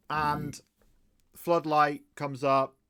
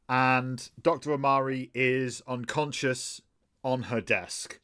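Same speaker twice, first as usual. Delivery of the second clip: normal talking voice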